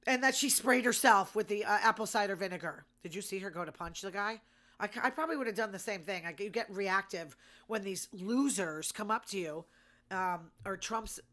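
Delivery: usual speaking voice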